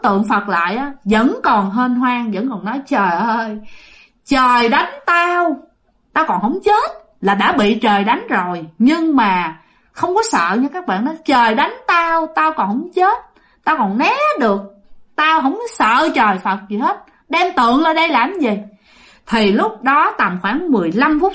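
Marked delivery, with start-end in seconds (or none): 2.9-6.9 possessed ghost's taunt. Retold or imitated. Spirit taunting the monks, defiant. 11.2-18.6 higher-pitched defiant jeer; possessed ghost's taunt. Retold or imitated. Spirit mocking the monks who brought statues, defiant.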